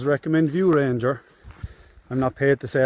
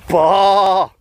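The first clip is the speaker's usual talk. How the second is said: sheep bleat; 5 semitones higher, 8 dB louder than usual. Shown as a demonstration. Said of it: "Up here they say baa".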